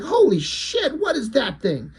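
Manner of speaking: high-pitched retold freak-out; playful high mock alarm. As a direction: act out panicked shock, pitch leaping up. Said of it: "he freaks out".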